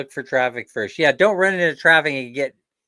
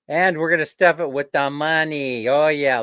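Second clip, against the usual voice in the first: normal talking voice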